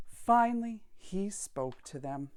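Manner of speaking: usual speaking voice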